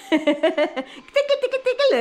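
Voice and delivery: rising, loud tickle chant; playful tickling. Real, not acted. Mother tickling the baby with a rising loud chant.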